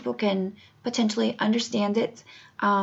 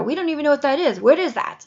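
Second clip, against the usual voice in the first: higher, louder baffled protest; retold exasperation, voice raised; indignant retort; 5 semitones higher, 4 dB louder than usual. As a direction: throw the question back in disbelief. Indignant retort to police questions.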